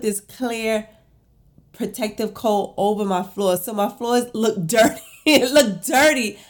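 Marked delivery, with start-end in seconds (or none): 3.6-6.4 laughing in disbelief, higher and louder. Real, not acted. Vlogger to viewers, laughing in disbelief. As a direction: laugh through the frustration. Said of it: "I'm laughing right now".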